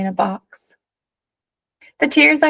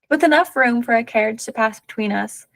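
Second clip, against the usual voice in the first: ordinary delivery, nothing marked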